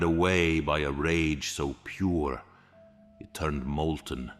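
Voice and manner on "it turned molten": ordinary delivery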